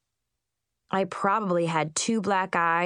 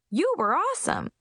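gushing; 10 semitones higher than usual. Acted. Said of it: "Chip gushed".

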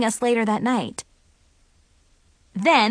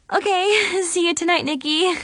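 high, giggly echo; giggling; 8 semitones higher than usual. A narrator's reading. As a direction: giggle it back shyly. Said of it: "I giggled".

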